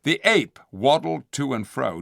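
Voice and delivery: usual speaking voice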